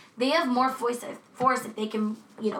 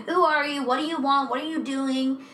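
raised-voice imitation of frantic questions; retold scream; 4 semitones higher, 3 dB louder than usual. Victim questioning the man attacking her, mock scream. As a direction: half-shout a rapid string of demands, pitched up. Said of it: "as you're screaming".

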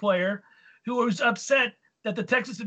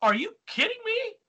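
incredulous; 12 semitones higher than usual. Retold or imitated. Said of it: "I was like".

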